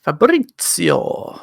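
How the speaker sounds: slow rolled Italian Fabrizio, fa-bu-ri-sio; fake Italian. Shown as a demonstration. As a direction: ring out Fabrizio in a hammy Italian accent. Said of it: "I do fake Italian".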